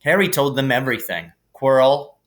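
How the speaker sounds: usual speaking voice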